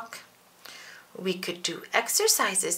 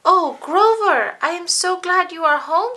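much higher, louder delighted mother; happy mommy; 9 semitones higher, 9 dB louder than usual. Acted. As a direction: greet him with joy at the door. Mommy greets Grover.

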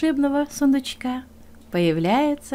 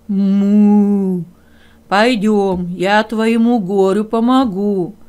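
louder, slow bull voice; bull's voice; 7 dB louder, much slower than usual. Acted. Bull offers to help the hare, loud and slow.

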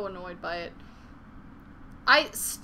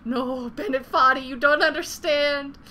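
mock old woman's lament; 3 semitones higher, 8 dB louder than usual. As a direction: plead like an old woman lost in memories. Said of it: "I'm just like".

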